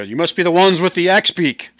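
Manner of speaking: loud, high call across the distance; shouting over. Acted. Human lady to the emerging party, shouting over. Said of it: "kinda shouts over to you".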